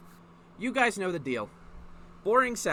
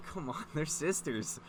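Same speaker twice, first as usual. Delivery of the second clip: ordinary talk, nothing marked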